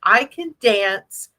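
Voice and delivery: ordinary delivery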